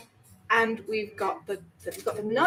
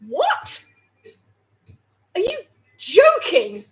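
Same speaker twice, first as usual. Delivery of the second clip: disbelief; 7 semitones higher, 6 dB louder, slower than usual. Real, not acted. Outraged by recipe instruction.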